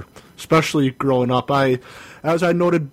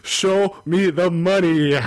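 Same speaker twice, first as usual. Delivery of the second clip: slow drawn-out catchphrase; 3 semitones higher, slower than usual. Shown as a demonstration. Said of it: "Dirty, you gotta yell".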